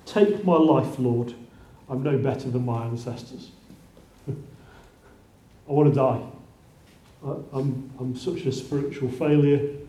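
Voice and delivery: low, soft, defeated prophet pleading to die; a hint of hopeless despair, quiet; despairing Elijah. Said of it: "Okay, he says this".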